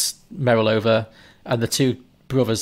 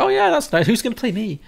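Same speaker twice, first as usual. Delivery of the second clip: mock delighted; 12 semitones higher than usual. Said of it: "Chris Cooper's like".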